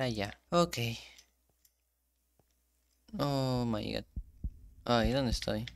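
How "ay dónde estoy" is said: normal talking voice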